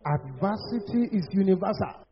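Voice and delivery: ordinary talk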